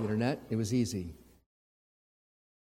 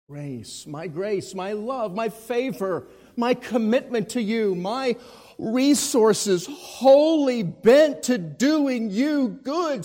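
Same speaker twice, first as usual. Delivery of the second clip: building preaching; 5 semitones higher, 8 dB louder than usual. Real, not acted. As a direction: build from hush to full force.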